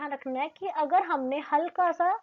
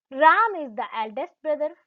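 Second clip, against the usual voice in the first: slow, louder example sentence; slow example read; 6 dB louder, much slower than usual. Shown as a demonstration. Teacher reads the example sentence to students slowly.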